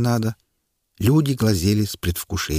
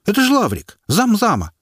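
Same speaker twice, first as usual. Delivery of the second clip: much higher, louder call; shouted from the crowd; 12 semitones higher, 4 dB louder than usual. A narrator's reading. Brave onlooker shouting the official's name, loud. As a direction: yell it out from the back. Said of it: "крикнул какой-то смельчак из толпы".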